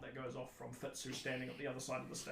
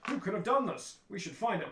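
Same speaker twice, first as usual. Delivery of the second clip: louder, higher hammed-up dismay; stagey investigators; 6 semitones higher, 6 dB louder than usual. Group walks on stage and finds the body, stagey alarm. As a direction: declaim it like amateur players. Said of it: "group sort of walks in on stage and goes".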